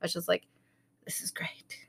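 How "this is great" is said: relieved whisper to herself. Retold or imitated. Mom relieved after the checkup, whispering. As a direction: breathe it out in a whisper.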